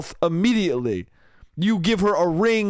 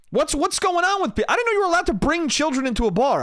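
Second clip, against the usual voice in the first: high-pitched, rushed disbelief; bewildered; 10 semitones higher than usual. Acted. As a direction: protest in a high, baffled voice. Acts bewilderment at kid in bar.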